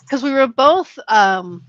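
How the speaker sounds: ordinary delivery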